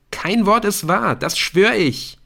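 high, loud, pleading denial; cornered boy raising his voice; desperate oath. A narrator's reading. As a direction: swear it with desperation. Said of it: "so etwas wie Verzweiflung in Eriks Stimme".

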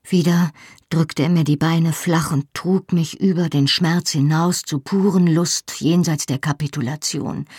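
ordinary delivery

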